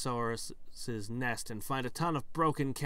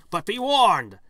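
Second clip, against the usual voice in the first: sudden loud, high cry of caution; dramatic warning; 9 semitones higher, 15 dB louder than usual. Acted. Narrator warning the player about the fish, dramatic shout. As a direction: boom it out as a mock-dire warning.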